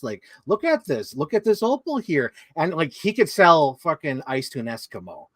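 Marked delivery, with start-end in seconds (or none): 0.5-2.3 sing-songy sales voice. Retold or imitated. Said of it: "the sing-songy voice".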